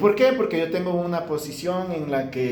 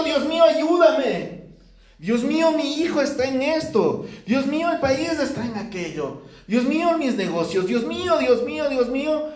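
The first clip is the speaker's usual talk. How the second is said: frantic plea; 7 semitones higher than usual. Retold or imitated. Preacher mimics believers running to God, frantically pleading. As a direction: beg God in a rising panic. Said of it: "Salimos corriendo".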